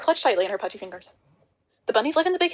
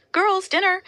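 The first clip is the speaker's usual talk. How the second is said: higher, louder call up the stairs; 10 semitones higher, 4 dB louder, much slower than usual. A narrator's reading. Farah's mom calling the girls up the stairs to dinner, loud.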